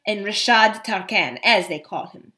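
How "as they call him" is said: ordinary delivery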